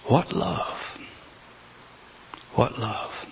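soft breathy wonder; whispered awe. Real, not acted. Preacher marveling at Jesus' love, whispered wonder. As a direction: breathe it out in reverent amazement. Awed exclamation after pause.

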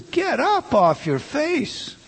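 slow, lifted divine command; voice of God. Retold or imitated. God tells Joshua on his face, slow lifted command. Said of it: "and God says".

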